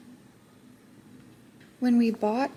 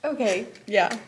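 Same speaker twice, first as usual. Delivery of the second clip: usual speaking voice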